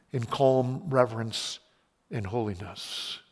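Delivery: slow, soft and calm; calm reverent hush. Acted. Preacher voicing God, calm and hushed. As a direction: ease into a hushed close.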